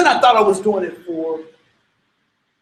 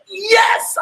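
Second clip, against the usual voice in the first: thrilled yell, much higher; excited yes; 10 semitones higher than usual. Retold or imitated. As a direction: shout it like the moment finally came. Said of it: "I am so excited".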